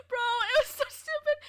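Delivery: high, loud, laugh-choked squeal; cracking up. Real, not acted. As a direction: squeal it helplessly at your own silliness. Cracking up at herself.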